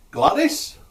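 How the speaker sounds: high, loud shout for an assistant; calling out. Acted. Healer calls assistant in back room, shouting. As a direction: holler through to the back room. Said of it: "he shouts to the back room".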